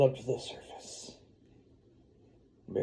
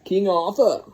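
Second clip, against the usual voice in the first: dramatic announcement; 7 semitones higher, 8 dB louder than usual. Acted. Repeating the name dramatically.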